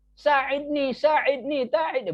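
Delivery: high, repeated pretend plea; mock urgent call for rescue; cry for help. Shown as a demonstration. Teacher showing the wrong way to call for help, acted plea. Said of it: "kalau meminta tolong".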